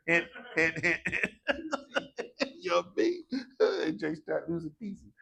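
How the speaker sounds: stammering through real laughter; helpless chuckles between phrases; laughing. Real, not acted. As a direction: crack up telling the joke. Cracking up at own joke.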